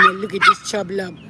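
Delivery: ordinary talk, nothing marked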